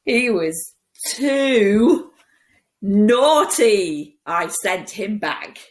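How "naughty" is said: ordinary delivery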